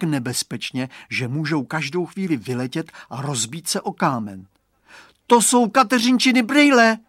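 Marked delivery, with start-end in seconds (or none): 5.3-7.0 put-on high, loud boyish shout; outraged cry. A narrator's reading. Boy spotting his friend's glasses, outraged shout. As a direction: cry out in protest. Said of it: "vykřikne Radovan".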